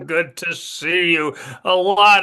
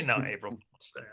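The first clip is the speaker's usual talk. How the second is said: much softer, quick teasing aside; dad's reveal; 4 semitones lower, 10 dB quieter, faster than usual. Retold or imitated. Dad telling his kids it was only a prank, softly teasing. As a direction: toss off the punchline lightly.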